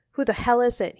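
sharp, irritated yell; angry shout. A narrator's reading. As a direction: shout it at whoever is intruding. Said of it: "he shouted".

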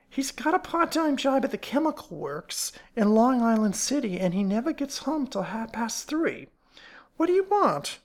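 high-pitched, wary character voice; suspicious fat woman. Acted. Fat woman explaining her husband's absence to strangers, suspicious. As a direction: climb sharply on the final demand.

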